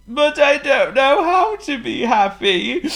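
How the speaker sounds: high, slow tearful voice; hammed-up weepy complaint; sniffling king. A narrator's reading. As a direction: sniff and whimper it out loudly. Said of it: "sniffed the king".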